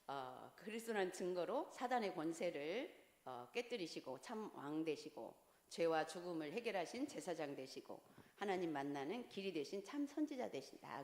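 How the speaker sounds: soft, subdued reading from the notes; reading aloud. Real, not acted. Teacher reading the proof of Christ to the group, reading aloud. Reads out study points.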